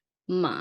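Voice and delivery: มา sunk low and soft. Shown as a demonstration. Tutor demonstrating for a student, low tone. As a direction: let มา sag at the bottom of the voice. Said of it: "then low tone".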